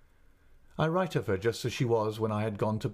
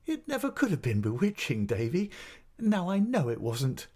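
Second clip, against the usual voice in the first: playful protest; 8 semitones higher than usual. Acted. Mother playfully protests, laughing.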